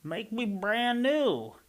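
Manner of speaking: high, slow, drawn-out voice; eerie slow voice. A narrator's reading. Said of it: "A voice from the puddle said".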